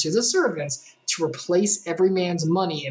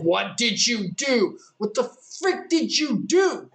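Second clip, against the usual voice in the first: exasperated father; 5 semitones higher, slower than usual. Acted. Jacob scolds sons over money.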